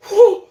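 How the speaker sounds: loud, high-pitched "whew"; high whoop. Retold or imitated. Looking at the woman on the bus, high whoop. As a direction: let out a sharp mock whoop. Acts his reaction to staring woman.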